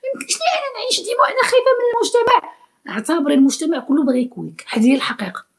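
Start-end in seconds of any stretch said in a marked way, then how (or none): 0.0-2.5 very high squeaky mock girl voice, partly rushed; mocking naive girl. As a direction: parody a timid girl in a shrill, much higher put-on voice. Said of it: "ما تقوليش لي".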